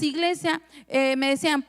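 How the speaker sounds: ordinary delivery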